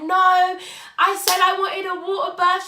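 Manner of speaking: high, loud angry protest; angry shout. Retold or imitated. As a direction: shout it back, furious at the change of plan. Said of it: "Like, I was proper angry".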